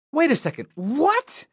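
high startled wait a second; sudden high-pitched alarm; surprised. Acted. Reader shocked by the book.

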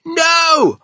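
high, very loud outcry; pained cry. A narrator's reading. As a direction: cry out as a suffering giant. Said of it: "cried the B F G".

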